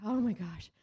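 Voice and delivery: acted relieved outburst; retold relief. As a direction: let the relief out on a retold exclamation. Retold relief after choking scare.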